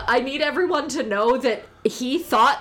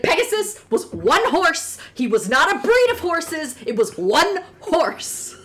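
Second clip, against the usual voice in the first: raised, emphatic mock exasperation; imitated rant; 6 semitones higher, 4 dB louder than usual. Podcaster ranting about Pegasus plurals, imitated exasperated rant. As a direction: pitch it up and hammer "one", slowing at the end. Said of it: "has this rant every time".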